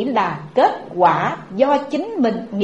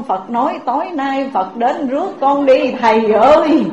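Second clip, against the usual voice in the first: desperate pleading; 2 semitones higher than usual. Acted. Terrified old woman begs monk.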